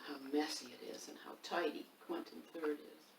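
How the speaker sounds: fast whispered aside. Real, not acted. Reader whispers a secret aside to the children. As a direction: lean in and whisper it quickly. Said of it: "unvoiced whisper aside".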